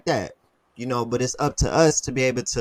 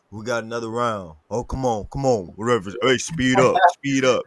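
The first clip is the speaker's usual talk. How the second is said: louder barking gym coach; 5 dB louder than usual. Retold or imitated. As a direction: drill a tired athlete like a trainer. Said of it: "So I'm doing my coach voice".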